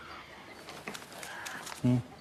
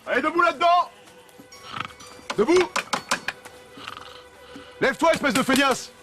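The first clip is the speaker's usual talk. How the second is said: shouting. Acted. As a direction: bark them out of bed. Waking workers with orders.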